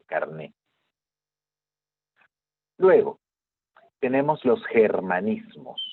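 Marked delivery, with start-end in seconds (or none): 0.0-0.5 carné, stressing the final é, with no t at the end; carné con acento en la e. Shown as a demonstration. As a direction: say carné like carne but land on the é. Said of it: "con tilde en la E". (carné, acentuando la é final y sin la t)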